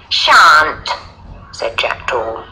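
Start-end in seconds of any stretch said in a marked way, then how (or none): 0.1-0.9 loud, defiant one-word refusal; defiant boy. A narrator's reading. Bully refusing the lion, loud defiance. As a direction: refuse like a cheeky boy, punched out.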